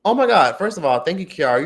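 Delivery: high, loud burst of real gratitude; excited thanks. Real, not acted. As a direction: exclaim it, thrilled by the gift. Thanking viewer for super chat.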